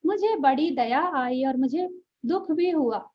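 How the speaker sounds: ordinary talk, nothing marked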